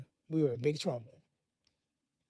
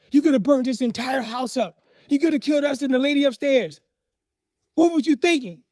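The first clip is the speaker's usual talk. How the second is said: raised pitch, building outrage; scolding father; 6 semitones higher, 4 dB louder than usual. Retold or imitated. Father to boys who played with matches, angry scolding. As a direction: imitate an angry dad's tirade.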